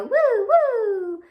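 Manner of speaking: high, loud playful woo woo. Acted. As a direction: whoop like a spinning yo-yo. Yo-yo noises in the rhyme.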